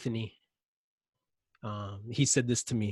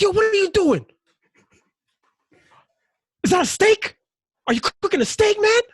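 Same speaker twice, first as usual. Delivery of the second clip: much higher, louder yell; yelling at a kid; 10 dB louder than usual. Retold or imitated. Man yelling at a boy at the grill, loud high shout. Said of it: "so I yelled at him".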